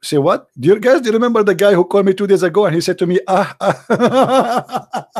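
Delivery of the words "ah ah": breathy ah-ah chuckle; imitated laugh. Recalling the caller, a mock laugh.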